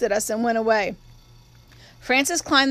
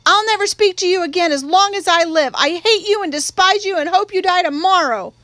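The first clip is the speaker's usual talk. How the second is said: furious outburst; 6 semitones higher, 5 dB louder than usual. A narrator's reading. Girl screams at uncle after spanking.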